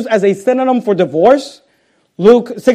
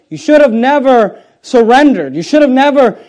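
louder, higher mock yell at the general; retold angry yell; 5 semitones higher, 5 dB louder than usual. Bitter woman yells at the general on the road, louder and higher. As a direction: voice her shouting that he never should have quit. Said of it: "begins to yell at him".

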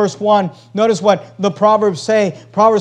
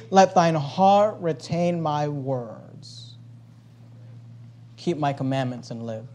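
slow, much softer and lower; hushed scripture reading; 7 semitones lower, 10 dB quieter, slower than usual. Acted. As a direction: let it sink in, softly and slowly. Reads the father's words.

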